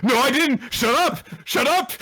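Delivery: put-on high, loud kid yelling shut up; childish squabble. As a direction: bicker like a six-year-old. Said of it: "they're arguing like six-year-olds".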